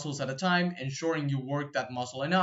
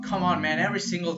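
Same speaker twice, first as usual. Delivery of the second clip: louder, exasperated complaint; 4 semitones higher, 6 dB louder, faster than usual. Real, not acted. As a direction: protest at the interruption, louder. Annoyed by motorbike noise during recording.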